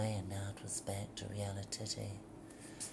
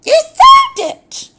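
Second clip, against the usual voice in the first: very high, very loud shriek, falling on "sold"; 30 dB louder than usual. Shown as a demonstration. Demo of a line read as a shriek. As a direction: screech "You sold it!" with a fall. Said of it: "And it's a shriek".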